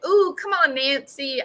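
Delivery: taunting. Retold or imitated. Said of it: "she's taunting Nancy Grace".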